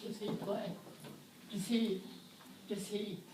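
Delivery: "Does he" with no H, run together; dropped h. Shown as a demonstration. Teacher showing the reduced H to the class. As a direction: say "Does he" softly, the H vanishing. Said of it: "You hardly hear the H".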